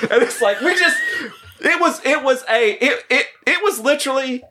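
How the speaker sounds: stammering through high-pitched laughter; laugh-stutter, words tumbling out. Real, not acted. Laughing recalling the bromance.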